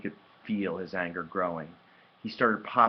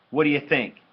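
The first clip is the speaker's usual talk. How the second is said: raised, irritated shout back; 6 semitones higher, 8 dB louder than usual. A narrator's reading. Hurt brother answering his sister's offer, shouted. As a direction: snap it loudly and higher. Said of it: "he shouted".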